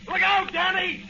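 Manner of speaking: loud, very high alarmed yell; warning shout. Acted. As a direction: scream the warning to save him. Warning of machine gun.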